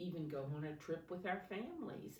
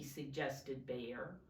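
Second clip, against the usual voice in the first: ordinary delivery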